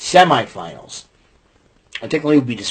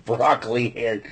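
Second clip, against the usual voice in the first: laughing; 7 dB quieter than usual. Real, not acted. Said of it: "I'm laughing".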